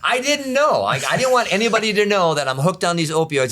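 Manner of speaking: squeaky, loud mock protest; comically high self-excusing wail; high-pitched defensive protest. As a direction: plead ignorance in a high, loud voice. Retold panicked self-defence to doctor.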